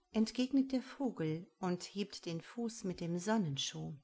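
ordinary delivery, nothing marked

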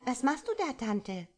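put-on high, bright little-girl voice, curious. A narrator's reading. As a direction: ask like a small curious child, pitched high. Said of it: "Ein kleines Mädchen kam ... und fragte".

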